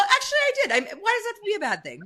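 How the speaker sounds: giggling, high and fast; laughing. Real, not acted. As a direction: laugh through the admission.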